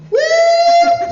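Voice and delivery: very high, loud sustained whoop; screamed woo-hoo. Acted. Teresa running around the fire, screaming woo-hoo.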